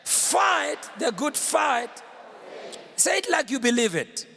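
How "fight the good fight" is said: stretched, emphatic "Fight"; half-speed, word by word for the crowd; slow call to repeat. Shown as a demonstration. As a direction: lead a congregation in recitation. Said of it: "Let me hear you again".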